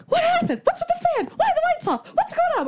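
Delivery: very high, panicked string of questions; retold freak-out. Girlfriend finding fan down and lights off, freaking out. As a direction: squeal each question in mock panic. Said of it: "I'm totally freaking out".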